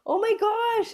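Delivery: high delighted gasp of words; delighted surprise. Retold or imitated. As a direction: squeal it in disbelief. Bug reacts excitedly.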